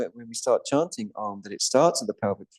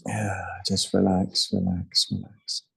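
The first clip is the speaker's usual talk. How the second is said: soothing guidance; 3 semitones lower than usual. Real, not acted. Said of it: "take a deep, deep breath".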